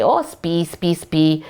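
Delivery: cooed pet name; loving. Shown as a demonstration. Owner sweet-talking her dog by nickname, loving. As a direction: coo the nickname fondly to a dog. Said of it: "you're just being loving".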